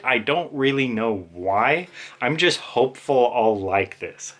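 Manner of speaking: ordinary delivery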